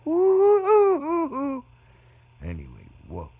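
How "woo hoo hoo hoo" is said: loud, high drawn-out whoop; long falsetto woo-hoo whoop; whooping cheer. Real, not acted. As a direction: hoot with delight. Cheering after punchline.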